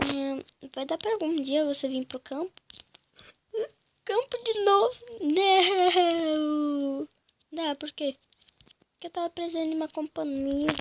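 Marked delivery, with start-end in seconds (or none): 4.0-7.1 whiny cry. Acted. Whining at going to the countryside.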